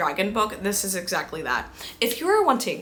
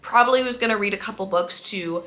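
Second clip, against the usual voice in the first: ordinary talk, nothing marked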